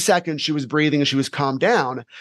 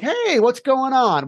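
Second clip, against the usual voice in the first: higher, slowed, smiling friendly hello; cheerful greeting; 8 semitones higher, slower than usual. Retold or imitated. Teacher greeting a panicking girl, smiling and slow. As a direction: greet her with a smile, easy and light. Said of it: "with a smile on my face".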